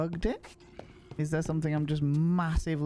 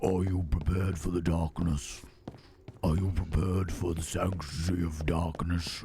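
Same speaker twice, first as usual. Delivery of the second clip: low, eerie mascot voice, fading to a whisper; spooky whisper of a creepy mascot; 6 semitones lower than usual. Retold or imitated. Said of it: "this eerie voice".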